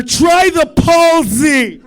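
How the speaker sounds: loud, slow, laughing call; mock barfly shout. A guy in the corner calling out, laughing shout. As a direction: shout the tip across the bar.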